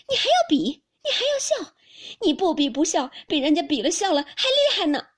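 very high, loud outrage; furious retort. A narrator's reading. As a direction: fling his words back at him, pitch soaring. 黛玉怒驳宝玉. (又尖又响地愤怒反驳)

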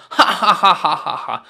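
head-back mocking belly laugh; performed peal of derisive laughter; loud acted laugh. A narrator's reading. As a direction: burst into scornful ha-ha laughter. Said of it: "那女子仰头大笑".

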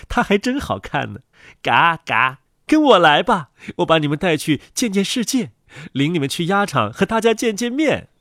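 high, excited duck mother; high-pitched, bustling motherly enthusiasm; proud mother duck. Acted. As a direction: call brightly to your little brood. Proud mother duck calls ducklings.

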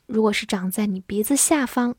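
ordinary talk

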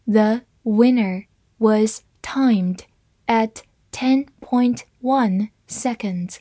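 slow, careful read-through; deliberate slow model sentence. Shown as a demonstration. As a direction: read it slowly for the class. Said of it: "好，慢读一遍。".

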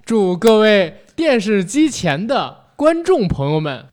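slow, loud gala host greeting; cheerful new year wishes. Pretend. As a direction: beam out holiday wishes to the audience. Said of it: "我以为你说咱模仿的这个开场呢".

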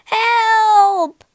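loud desperate cry for help; shouting. A narrator's reading. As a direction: yell it out in panic. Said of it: "he shouted again".

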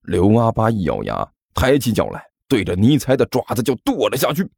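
plain reading voice

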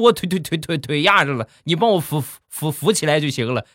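high, exasperated stutter; hammed-up stuttering protest; stammering old man. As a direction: trip over syllables, pitch climbing as you complain. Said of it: "结巴".

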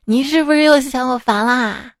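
high, playful mock whine with giggles; coy whining wife. A wife pestering her husband, coy and giggly. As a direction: tease him like a spoiled wife. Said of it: "我就撒娇跟老公".

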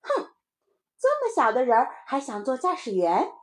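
huff, then mock your playmate; scoffing little child. (小孩高声不屑地嘲笑)

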